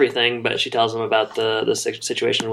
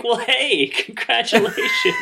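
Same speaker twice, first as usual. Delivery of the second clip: higher-pitched mock cheer, breaking into laughter; Brad's reaction; 10 semitones higher, slower than usual. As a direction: imitate a surprised congratulation, laughing through it. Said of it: "Brad's kind of reaction".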